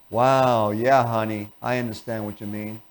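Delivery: reply to your spouse without caring; bored. Shown as a demonstration. Husband soothing his wife, bored and soft. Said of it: "you could also use boredom".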